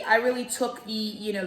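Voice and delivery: normal talking voice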